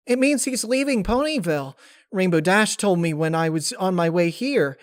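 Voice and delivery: high, loud rush of news; excited Pinkie. Acted. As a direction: blurt it all out.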